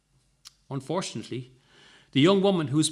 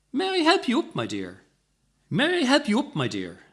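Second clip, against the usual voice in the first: put-on high, loud gallant old gent; courtly offer, high then dropping; old gentleman; 9 semitones higher, 4 dB louder than usual. A narrator's reading. Old gentleman offering help, courtly and high.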